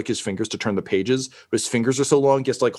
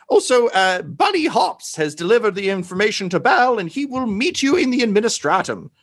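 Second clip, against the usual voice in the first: higher, urgent guide figure voice; a lawyer-world figure briefing the heroes, pitched up; high character voice; 10 semitones higher, 3 dB louder than usual. Acted. GM voicing a helper, high character voice.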